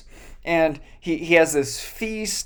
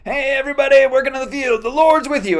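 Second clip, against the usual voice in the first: Boaz greeting; 9 semitones higher, 3 dB louder, faster than usual. Retold or imitated. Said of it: "he comes out and he goes".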